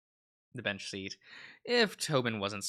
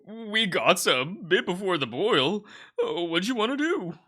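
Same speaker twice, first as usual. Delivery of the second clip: character voice; 8 semitones higher, 5 dB louder than usual. Acted. Friend character voiced in game.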